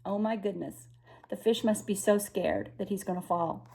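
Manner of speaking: ordinary delivery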